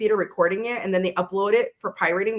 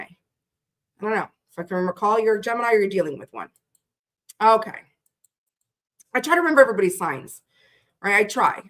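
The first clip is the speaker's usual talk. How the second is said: ordinary delivery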